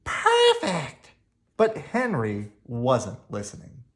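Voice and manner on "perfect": very high, beaming praise; delighted child. Acted. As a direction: praise the dog with joy. Henry praising the dog.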